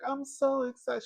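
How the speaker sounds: high-pitched mock singing; Jessie. Recalling Saved by the Bell, imitated high singing. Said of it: "when Jessie was like".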